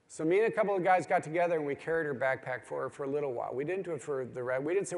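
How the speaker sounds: usual speaking voice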